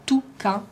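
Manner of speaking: "toucan" broken into two distinct beats; "toucan" syllable by syllable; syllable split. Shown as a demonstration. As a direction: say "toucan" slowly, pausing between syllables. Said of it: "dans la première syllabe ou dans la deuxième".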